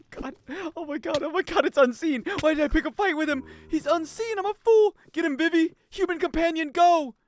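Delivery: high, loud frantic yelling; frantic high yells at companion; panicked. Real, not acted. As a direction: scream for help as the zombie comes. Panic while zombie attacks.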